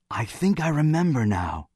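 high-pitched, relieved recollection. A narrator's reading. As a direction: break in with dawning relief. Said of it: "his eyes bright and relieved".

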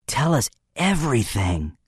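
a conspiratorial husky plea. A narrator's reading. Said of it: "said huskily".

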